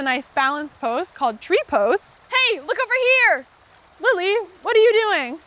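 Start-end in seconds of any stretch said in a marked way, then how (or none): 2.3-5.4 high, excited calling out. Real, not acted. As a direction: call across the room to Lily. Calling to another child mid-class.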